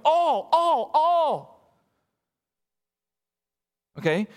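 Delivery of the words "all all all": all all all, high, louder and pressed; emphatic all. Shown as a demonstration. Preacher stressing Paul's key word all, hammering all. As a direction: stack each all like hammer blows. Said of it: "he is trying to make a very important point".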